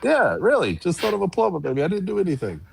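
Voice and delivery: high-pitched mock tearful protest; Dusty impression. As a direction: imitate a wrestler wailing that he's innocent. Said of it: "but Dusty's, like, crying".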